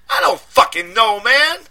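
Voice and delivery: high, loud street voice in a panic; panicked friend. A narrator's reading. Deshaun panicking down the phone at his friend, loud and high. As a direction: yell it like you're scared and angry.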